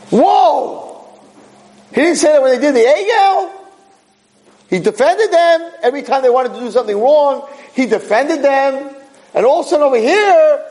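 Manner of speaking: high-pitched astonished protest. Acted. Teacher marvelling to the class at Moshe's outburst, astonished. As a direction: exclaim in high-pitched disbelief.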